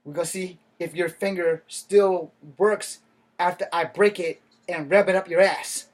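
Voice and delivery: loud menacing threat; acted threat. Threatening a driver on camera, loud menace. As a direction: threaten him, loud and mean.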